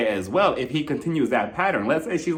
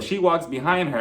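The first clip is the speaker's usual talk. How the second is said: ordinary talk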